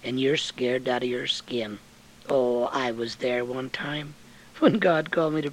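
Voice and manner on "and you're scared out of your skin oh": usual speaking voice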